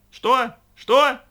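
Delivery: high, loud, rising yell; shouting. A narrator's reading. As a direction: bellow that you can't hear. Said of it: "оба они орали во всё горло".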